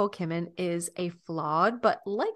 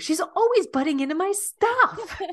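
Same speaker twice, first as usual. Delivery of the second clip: much higher, louder mock-whiny complaint; playful sisterly whine, pitched way up; Sandra Bullock impression; 11 semitones higher, 5 dB louder than usual. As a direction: whine it up high like a pestered sister. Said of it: "Sandra Bullock goes".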